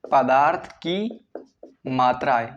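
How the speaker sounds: very slow, words spaced apart; slow dictation. Real, not acted. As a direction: dictate it slowly for note-takers. Repeats definition slowly for note-taking. (धीमे-धीमे लिखवाते हुए)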